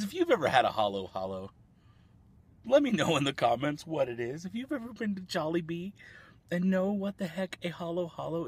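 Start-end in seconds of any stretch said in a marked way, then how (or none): none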